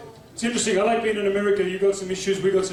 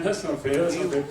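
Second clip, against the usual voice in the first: ordinary talk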